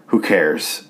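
ordinary talk, nothing marked

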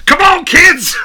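high, loud coach yell; coach shouting. Pretend. Playing coach yelling.

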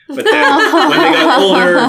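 laughing. Real, not acted. Laughing through retelling.

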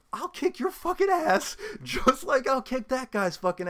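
high, loud challenge; heated threat. Real, not acted. Ranting at arrogant bosses, a high heated threat. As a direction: throw the threat out hot. Threat during his rant.

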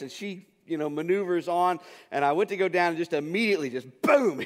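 ordinary talk, nothing marked

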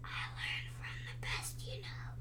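soft breathy whisper, quick. A narrator's reading. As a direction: lean in and whisper it fondly. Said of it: "and whispered".

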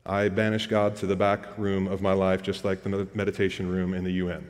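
low, soft mock admission in a monotone; deadpan confession. Imagined churchgoer admitting he sidelines God, deadpan. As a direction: own up blandly, low and understated.

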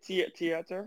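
"theater" softly tried as "tee-AH-ter"; unsure pronunciation. Shown as a demonstration. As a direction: ask with a halting false start, "theater" as "tee-AH-ter". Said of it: "how do you say it".

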